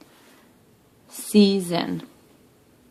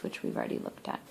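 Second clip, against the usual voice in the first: usual speaking voice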